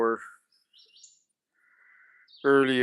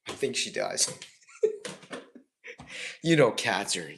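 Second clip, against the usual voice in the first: ordinary talk, nothing marked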